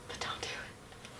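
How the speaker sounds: a hushed, conspiratorial whisper. Real, not acted. Vlogger whispers a joking warning against buying pricey mascara.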